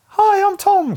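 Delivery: squeaky falsetto mimicry of a teasing kid; mocking high voice. Schoolmates teasing him about his high voice, mocking squeaky falsetto. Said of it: "poke fun at me and be like".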